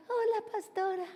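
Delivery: high, small fake-sweet greeting; silly simpleton voice. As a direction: greet the pastor like a put-on goody-goody child. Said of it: "esa voz como de bobito". (vocecita aguda de bobito)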